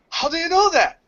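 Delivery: stunned teacher asking how he knew; astonished question. Retold or imitated. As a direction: ask it like you can't believe your ears. Teacher amazed at student's knowledge.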